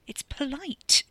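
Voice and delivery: high, tense reproof; hissed. A narrator's reading. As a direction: insist on it in an urgent hiss. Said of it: "Carrie hissed, lowering her voice".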